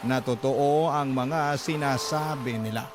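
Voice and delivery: ordinary delivery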